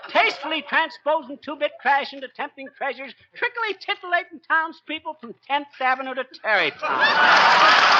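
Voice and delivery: fast showman's brag, building to a laugh; rapid boastful patter. Acted. McGee bragging to Molly, rattling off alliterative titles fast.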